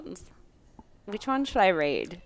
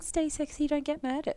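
imitating other podcast; 7 semitones higher, 4 dB quieter than usual. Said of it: "if we started saying".

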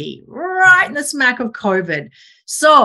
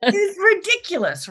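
laughing; 10 semitones higher than usual. Real, not acted. Laughing at own ignorance.

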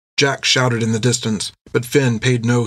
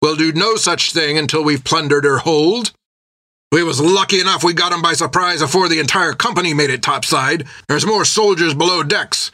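higher rough sailor voice, arguing; captain overrules Jack with greed; gruff pirate captain; 5 semitones higher than usual. Acted. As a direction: insist on plunder first.

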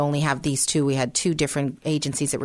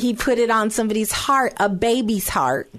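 higher, louder joyful telling; excited; 6 semitones higher, 4 dB louder than usual. Real, not acted. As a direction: still thrilled, riding the joy. Thrilled retelling a blessing.

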